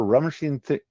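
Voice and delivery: ordinary delivery, nothing marked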